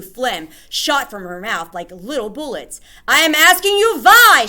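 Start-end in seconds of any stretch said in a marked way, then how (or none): 3.1-4.5 very high, loud furious scream; screamed demand. Acted. Grand High Witch screaming at silent witches. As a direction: demand an answer. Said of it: "she screamed".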